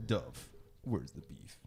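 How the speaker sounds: slow hushed tagline; whispered ad line. Pretend. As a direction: whisper it like a commercial. Whispered mock ad.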